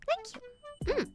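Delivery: high tiny soft thank you; tiny high chibi voice. Pretend. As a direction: squeak it out like a chibi.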